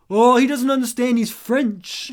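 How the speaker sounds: loud, mocking teenage lad; teenage boy. Teenage friend covering for his mate, loud boyish mockery. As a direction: call it out like a cheeky teenage boy. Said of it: "in this teenage boy way".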